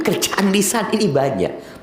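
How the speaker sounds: ordinary talk, nothing marked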